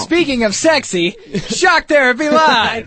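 high, loud parody plug, title stretched out; mock announcer shout. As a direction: belt out the show name in hype parody. Echoing the mock show plug.

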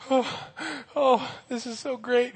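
a lightly acted weepy happy sigh; a hint of crying with happiness; tearful joy. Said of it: "he's wiping away the tears".

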